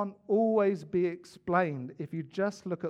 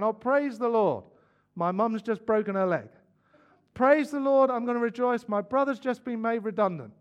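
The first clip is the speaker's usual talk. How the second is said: high, loud show of forced praise; hammed-up jubilant cry, pitched well up; mock rejoicing; 5 semitones higher, 7 dB louder than usual. Preacher parodying Christians who rejoice at bad news, mock-jubilant.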